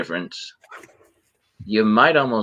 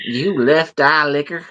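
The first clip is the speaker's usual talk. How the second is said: slow Southern drawl, pitched up; southern accent; 7 semitones higher, 3 dB louder, slower than usual. Shown as a demonstration. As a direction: drawl it like a Texan. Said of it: "I say that in a southern accent".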